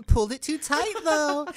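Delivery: high, loud mock-cutesy voiceover; Gigi voiceover impression. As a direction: parody a vlogger's playful aside. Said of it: "cuts to her putting her ponytail up".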